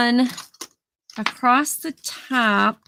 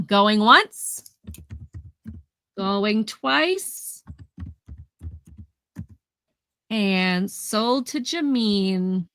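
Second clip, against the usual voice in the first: auctioneer countdown; much slower than usual. Real, not acted. Auction countdown to close sale.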